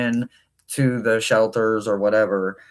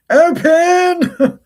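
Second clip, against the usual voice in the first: far higher, loud squeaky "open"; strained squeak; 8 dB louder than usual. Real, not acted. To the stuck deck box, a high loud squeak.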